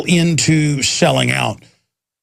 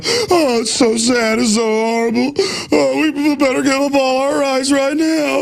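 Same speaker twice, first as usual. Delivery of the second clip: much higher, syrupy fake grief; mock sentimental whine; 10 semitones higher than usual. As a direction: parody a sobbing news anchor, all fake sorrow. Mocking the media's grief over shootings.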